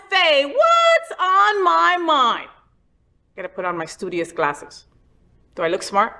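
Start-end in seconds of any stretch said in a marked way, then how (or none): 0.1-2.5 higher, very slow announcer voice drawing out What's on My Mind; segment title announcement. Acted. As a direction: present the segment title like a jingle. Host announces show segment.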